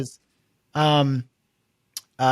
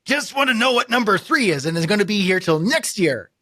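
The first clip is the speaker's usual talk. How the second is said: much higher, exasperated whine; impatient caller; 6 semitones higher than usual. Retold or imitated. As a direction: whine at the endless menu.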